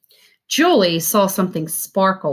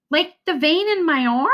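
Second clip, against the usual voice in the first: put-on higher, puzzled little-girl voice; girl's puzzled question; 8 semitones higher than usual. A narrator's reading. Julie asking her dad, higher puzzled child voice. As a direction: pitch up as a confused child. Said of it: "She traced the light blue vein".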